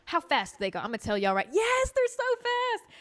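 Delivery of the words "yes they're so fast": high, slow and drawn-out; excited. Acted. Telling viewers about centipedes, high and drawn out. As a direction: stretch it out, squealing.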